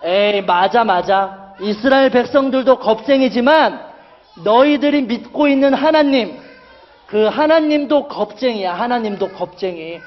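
jeering, building to a high, loud challenge; mock them, ending in a loud bellow and laugh; taunting. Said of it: "이렇게 놀리는 거예요".

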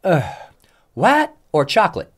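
loud, high exasperated counter lady; disgusted. Retold or imitated. Counter lady to a customer, mock disgust. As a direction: mimic a fed-up clerk, loud and high. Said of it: "And she gets a disgust".

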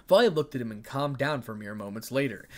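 plain reading voice

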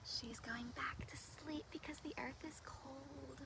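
very soft, breath-only whisper; whispered aside. Acted. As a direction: whisper it to the children so as not to wake her. Whispered aside about sleeping creature.

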